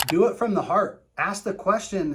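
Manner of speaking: ordinary delivery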